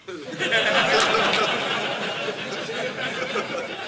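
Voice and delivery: long, high breathy laughter. Real, not acted. Speaker enjoying his punchline before the audience, high and breathy.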